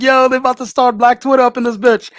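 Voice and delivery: mock excited quote. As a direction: hype it up like big news breaking. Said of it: "I was like".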